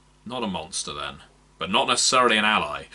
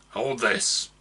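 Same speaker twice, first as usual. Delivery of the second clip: gruff request; 2 semitones higher than usual. Acted. Orc hands him wreath.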